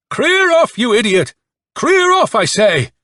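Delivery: very high, loud commanding yell; bugle-like yell. A narrator's reading. Colonel ordering Nikolka away, yelling. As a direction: yell like a muted cavalry bugle. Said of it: "yelled in a voice that again sounded to Nikolka like a muted cavalry bugle".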